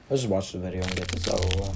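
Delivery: low, quiet, hurried resignation; reluctant. Real, not acted. Reviewer about to play a hated prop's video, reluctant. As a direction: get it over with, softly and quickly.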